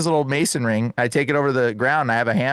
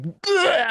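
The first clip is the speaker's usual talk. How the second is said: high-pitched mock heave; imitated retching. Man being prayed over, mock vomiting. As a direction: act out throwing up hard. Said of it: "he starts throwing up like crazy".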